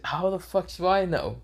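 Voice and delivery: clueless. Acted. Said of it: "Baruto says".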